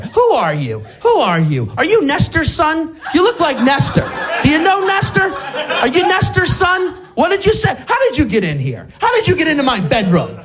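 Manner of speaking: put-on confused old woman. Joke with Alzheimer's disoriented.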